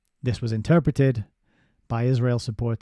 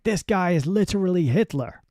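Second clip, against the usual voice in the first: mock pompous pundit; 7 semitones higher than usual. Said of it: "I'm sure he was like".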